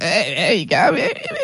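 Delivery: a lightly done, rough mock of Beckham, ending in a big leap up with a laugh; mocking David Beckham's voice. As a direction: tease his voice half-heartedly and crack up on the last hey. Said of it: "I can't do his impersonation".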